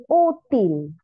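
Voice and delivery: Útil with a loud, drawn-out Ú; teacher sounding out Útil as Ú, til; stress on penultimate syllable. Shown as a demonstration. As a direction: punch the first syllable, Ú, in Útil. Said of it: "Tiene el acento en la penúltima".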